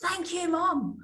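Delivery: imitating a small son, high-pitched; high little-boy voice for a quote; young son. Son thanking his mum for the washing, in an imitated high child's voice. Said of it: "my son came in and he went".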